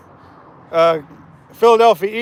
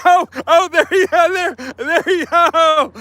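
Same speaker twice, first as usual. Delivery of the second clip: laughing; 8 semitones higher, 4 dB louder than usual. Real, not acted. Laughing at buddy's Mahomes run.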